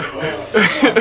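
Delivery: sharp imitated gasp; gasp for air. Boy airborne on the dip, gasping. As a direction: suck in air as if surfacing. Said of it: "and I go".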